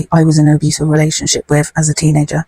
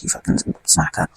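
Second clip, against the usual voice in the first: whispered; 9 dB quieter than usual. Retold or imitated. Whispered child's voice quoted.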